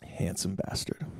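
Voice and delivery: soft, slow whispered joke; whispered aside. Real, not acted. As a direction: slip it in quietly to the audience. Aside about man who models.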